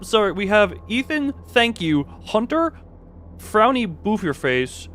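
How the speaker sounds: usual speaking voice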